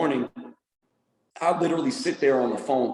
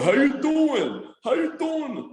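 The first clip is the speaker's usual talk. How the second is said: high, slow Brooklyn "How you doing?"; Brooklyn accent; 11 semitones higher, slower than usual. Retold or imitated. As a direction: stretch it out like a Brooklyn dad. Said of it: "my parents are from Brooklyn".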